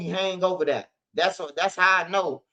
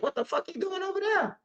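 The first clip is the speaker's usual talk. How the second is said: squeaky high, incredulous voice; a high, soft, disbelieving squeak; mock disbelief; 13 semitones higher, 7 dB quieter than usual. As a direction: jump way up in pitch, half laughing in disbelief. Disbelief at the killing, retold.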